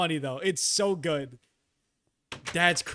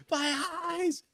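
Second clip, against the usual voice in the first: hammed-up cry of agony, pitched way up; imitated scream of pain; 12 semitones higher, 4 dB quieter than usual. Mimicking the scalded girlfriend, high mock-pained wail. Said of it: "Just the screaming".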